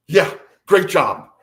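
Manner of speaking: mock praise with a bite; sarcastic. Grey mocking the abductee after the failed visit, biting sarcasm. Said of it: "So sarcastically, so bitingly".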